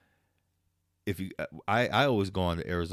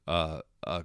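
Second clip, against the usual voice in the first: ordinary talk, nothing marked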